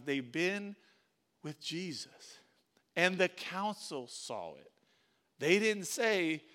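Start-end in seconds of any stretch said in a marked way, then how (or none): none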